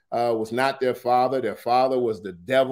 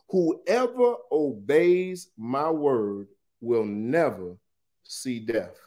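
weighty declaration; slower than usual. Acted. Preacher reads Jesus's promise to listeners, slow and weighty. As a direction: let each phrase land separately.

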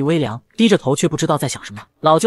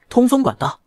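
higher, startled question; shocked; 7 semitones higher than usual. A narrator's reading. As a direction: blurt it out in disbelief. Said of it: "邵玉生震惊了".

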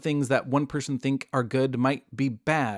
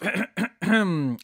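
a light throat clear to announce himself; 5 semitones higher than usual. Acted. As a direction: clear the throat to get attention. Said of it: "announces himself".

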